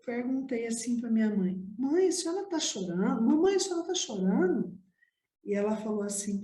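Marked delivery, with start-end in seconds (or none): 1.8-4.8 lightly voiced child, higher pitched; retold child's question, pitched up; worried child. Little girl to her crying mother, worried and higher.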